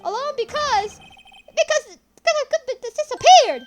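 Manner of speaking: high officer voice building to a shout; Officer Minkins. Acted. Officer recounting waking alone, building to frantic shout.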